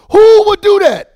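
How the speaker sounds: loud, high, astonished question to the crowd; incredulous. Real, not acted. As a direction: throw it out in loud disbelief.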